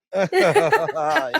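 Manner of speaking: high shrieking laugh; high burst of laughter. Real, not acted. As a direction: let out a squealing laugh.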